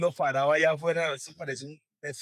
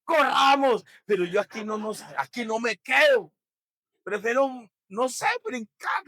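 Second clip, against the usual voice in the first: panicked cry; 7 semitones higher, 8 dB louder than usual. Retold or imitated. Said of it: "Y yo".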